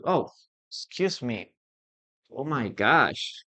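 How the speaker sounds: usual speaking voice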